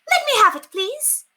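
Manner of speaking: very high, loud command; demanding. Acted. Lady loudly demands the letter from the maid. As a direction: grab at it with your voice.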